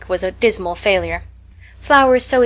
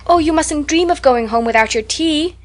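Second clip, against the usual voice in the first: higher, anxious plea; a touch of distress, pitched higher; distressed cry; 6 semitones higher than usual. A narrator's reading. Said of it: "cried Anne in distress".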